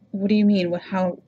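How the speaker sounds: ordinary talk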